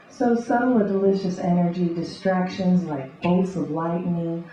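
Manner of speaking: rhythmic beat poetry reading; beatnik. Acted. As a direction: read it like a coffeehouse beat poet. Spoken word poetry reading.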